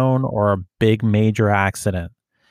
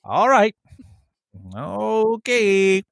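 mock agreement; 9 semitones higher, slower than usual. Mock giving in.

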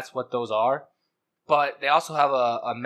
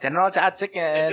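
higher-pitched mock Chinese accent, through laughter; 4 semitones higher than usual. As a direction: put on a caricatured Chinese accent. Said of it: "the, the accent, [name], was not necessary".

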